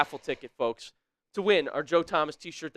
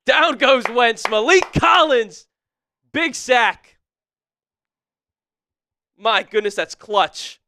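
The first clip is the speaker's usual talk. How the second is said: louder, higher, stretched sack call; excited play-by-play; 4 semitones higher, 6 dB louder, slower than usual. Real, not acted. Streamer calling the sack to his chat, excited.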